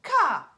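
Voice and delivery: sharp, high, loud bird caw; piercing raven cry. A narrator's reading. Raven calls out in story.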